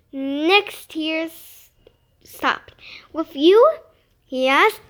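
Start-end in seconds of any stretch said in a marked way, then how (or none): 3.1-3.8 loud, rising surprise; a surprised outburst; surprised question. Acted. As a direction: blurt it out amazed. Nick surprised, hopeful question.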